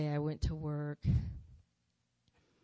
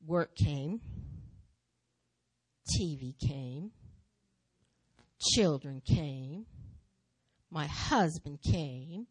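slow, each item set apart; slow, weighted list; much slower than usual. Real, not acted. Preacher listing life's distractions, slow and deliberate. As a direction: let each thing land slowly. Listing what crowded out her gifts.

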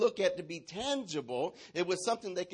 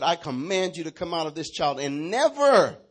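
commanding, swelling loud on never; stern rebuke; 8 dB louder than usual. Acted. Jesus orders the spirit out of the child, commanding. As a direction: order it out, building force to the last word. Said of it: "he rebuked the evil spirit".

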